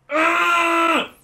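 high, loud exasperated ugh; loud frustrated groan. Real, not acted. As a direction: groan it out in anger. Gamer fails.

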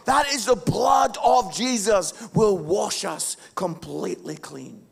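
loud, high shouted sermon climax; preaching at full force. Acted. Pastor proclaiming Jesus' forgiveness, shouted full-force preaching. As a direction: build to a ringing, shouted crescendo.

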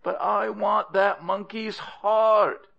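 high, slow, tearful whine; whining insistence. Acted. Crocodile wife begging her husband, whining insistence. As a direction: wail the demand, stretched out and high.

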